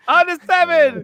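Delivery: echoing the telethon cheer; high, cheery host call. Pretend.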